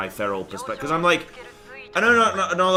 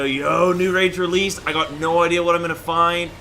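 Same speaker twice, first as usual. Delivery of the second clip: pumped, louder and higher; excited; 5 semitones higher, 6 dB louder than usual. Pretend. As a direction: hype up the raid team. Imagined raiders hyped call.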